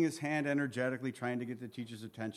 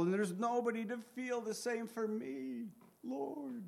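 high, plaintive wail fading softer; Job; 9 semitones higher, 3 dB quieter than usual. Acted. Job crying to the Lord that nobody feels for him, lamenting. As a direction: lament it, pitch climbing to a thin cry. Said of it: "Job's lamenting here again".

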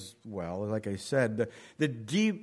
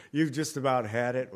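ordinary delivery

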